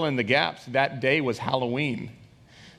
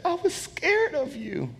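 put-on high, slow, small child's voice; drawn-out, high-pitched retold fear; scared child; slower than usual. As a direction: imitate a frightened son confessing. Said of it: "my son is shaking, and he's crying".

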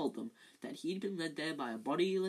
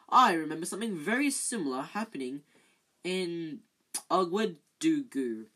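Lockhart voice; 7 dB louder than usual. Acted. Lockhart voiced in read story.

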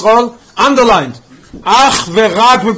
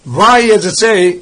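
usual speaking voice

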